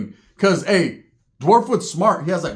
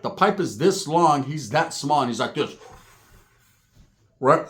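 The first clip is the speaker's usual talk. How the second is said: usual speaking voice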